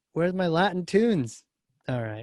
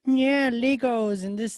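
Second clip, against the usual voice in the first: put-on kid sports voice; 7 semitones higher, slower than usual. Mocking kindergarten sports talk.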